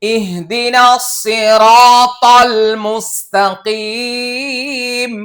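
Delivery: slow chanted verse recitation; Quran recitation. Acted.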